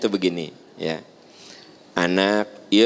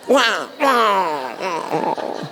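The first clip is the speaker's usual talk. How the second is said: mock fight noise; 7 semitones higher, much slower than usual.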